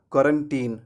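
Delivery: put-on क्वारंटीन ending in "teen"; correct pronunciation. Shown as a demonstration. Teacher answers what is correct, क्वारंटीन said with "teen". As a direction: finish क्वारंटीन on "teen", not "tine". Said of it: "तो सही क्या होगा".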